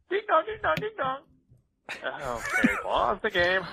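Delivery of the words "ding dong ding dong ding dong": high, repeated doorbell chime; sing-song high chiming; doorbell imitation. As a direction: ring insistently like a doorbell.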